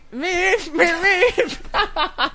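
squeaky high meeps, cracking up; laughing honk imitation. Shown as a demonstration. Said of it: "Honk and wave to strangers".

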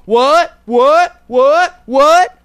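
shouted what, rising each time; hype-man yells, building; hype shouts. Pretend. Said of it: "My Reese's Puffs rap".

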